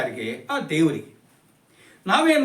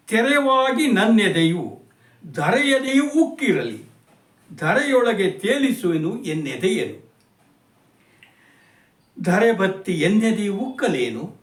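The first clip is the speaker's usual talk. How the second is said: poem recitation. Acted. Recites second stanza.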